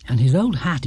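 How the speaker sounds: ordinary delivery, nothing marked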